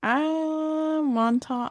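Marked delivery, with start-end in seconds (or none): 1.0-1.7 replace the K ending of "Montauk" with F. Shown as a demonstration. Co-host trying out the name, "Montauk" ending in F. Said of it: "where you got the F".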